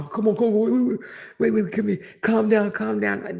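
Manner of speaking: imitating a friend holding her back; calming someone down. Retelling how the friend pleaded, calming her down. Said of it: "I had that joke to my".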